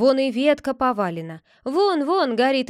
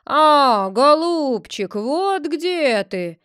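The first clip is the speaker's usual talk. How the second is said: loud, slow bear roar; 3 semitones higher, 7 dB louder, much slower than usual. A narrator's reading. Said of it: "заревел медведь".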